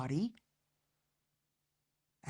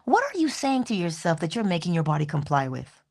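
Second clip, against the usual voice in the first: ordinary talk, nothing marked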